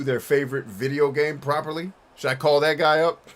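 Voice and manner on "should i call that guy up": usual speaking voice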